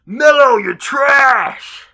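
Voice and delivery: loud, high yell from the stands; imitating a fan's shouted jeer; heckling shout. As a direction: heckle at full volume, drawing out the last word. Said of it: "one L A fan behind me shouts".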